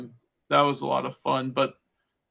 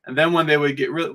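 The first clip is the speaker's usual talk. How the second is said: normal talking voice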